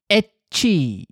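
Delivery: spoken sneeze. Shown as a demonstration. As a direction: sneeze read out as etchi. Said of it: "So he sneezes thus".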